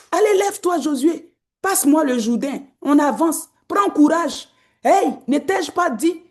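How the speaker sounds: preaching at full force. Acted. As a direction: rouse the room like God urging Joshua on. Preaching God's charge to Joshua.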